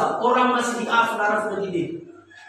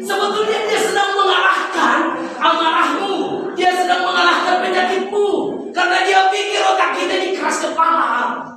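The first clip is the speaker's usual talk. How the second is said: raised, ringing proclamation; preaching at full force; 8 semitones higher, 4 dB louder than usual. Acted. Preacher proclaims healing to the congregation, ringing and raised. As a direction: call it out over the congregation.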